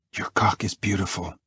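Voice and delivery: soft, intimate murmur in character; hushed American lover's voice; dirty murmur. A narrator's reading. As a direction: say it softly, as a lover's secret. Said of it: "Maybe say that again".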